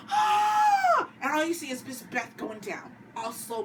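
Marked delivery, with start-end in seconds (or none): 0.1-1.1 high loud scream of shock. Retold or imitated. Everyone seeing Beth shot, shocked high scream. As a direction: let out a sudden high scream.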